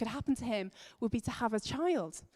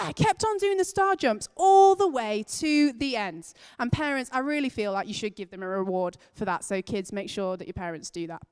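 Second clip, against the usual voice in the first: ordinary talk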